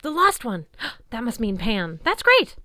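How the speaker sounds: high, loud eager cry; light up with hope; thrilled outburst; excited. A narrator's reading. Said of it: "Grover perked up".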